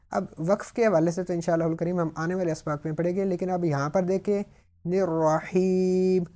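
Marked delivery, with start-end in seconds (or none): 4.8-6.2 very slow الرحیم with the ee held a full second; long ya. Shown as a demonstration. Teacher showing students the long ya in الرحیم. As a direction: hold the ee in الرحیم. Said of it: "یہاں پر بھی انہوں نے لمبا کیا".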